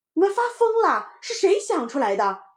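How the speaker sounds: high, loud outburst; scolding mother. Acted. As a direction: demand to know who's to blame. Mother scolds after finding bottle empty. (妈妈高声责骂)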